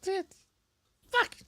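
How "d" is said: silly put-on lady voice; goofy character voice. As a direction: play a goofy woman character. Holds put-on voice.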